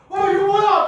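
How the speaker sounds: put-on high loud yell at a kid; sudden loud high outburst; startled mom yelling. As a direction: shout it as a mom spotting trouble. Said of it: "딱 이러면".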